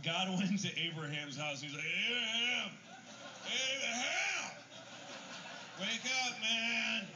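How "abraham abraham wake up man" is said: booming mock God call. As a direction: shout him awake in the night. God voiced calling Abraham.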